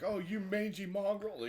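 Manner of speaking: high, slow mock outrage; hammed-up villain indignation; Zartan, pissed. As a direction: curse them in a high, affronted voice. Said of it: "So Zartan's pissed".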